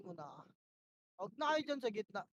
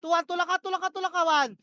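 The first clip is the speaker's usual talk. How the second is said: loud, high battle calls; yelled commands; 4 semitones higher, 10 dB louder than usual. Real, not acted. Shotcaller yelling pushes at his team. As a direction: shout orders over the chaos.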